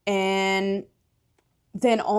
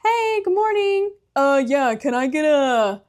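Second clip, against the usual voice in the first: higher, chirpy put-on voice for ordering coffee; imitating herself ordering at a café, a higher voice; mock customer order; 6 semitones higher, 4 dB louder than usual. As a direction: play-act ordering at a counter, voice pitched higher. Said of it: "I think I was like".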